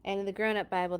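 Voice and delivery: ordinary delivery, nothing marked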